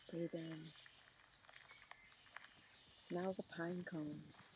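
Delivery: ordinary delivery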